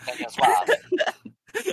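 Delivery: high, very slow, drawn-out wail of the name; mock-operatic crying out of the name; dramatic name call. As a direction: stretch the name out like a mournful cry. Echoing the film's dramatic name call.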